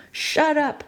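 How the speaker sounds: sharp, high snapped order; a guard yelling at a screaming woman to shut up; yelled. A narrator's reading. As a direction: bark it at the prisoner. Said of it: "one of the men yelled".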